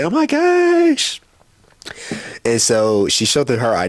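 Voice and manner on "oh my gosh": much higher, slow, gushing oh my gosh; swooning girl. Retold or imitated. Mimicking his date swooning, squeaky and high. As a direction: imitate her melting. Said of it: "She was like".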